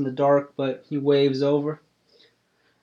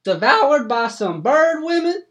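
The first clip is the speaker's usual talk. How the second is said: much higher country voice, stunned; an old farmer hearing the bad news; shocked hillbilly farmer; 13 semitones higher, 7 dB louder than usual. GM voices Cletus Ray.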